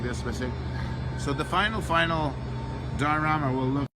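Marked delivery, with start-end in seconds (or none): none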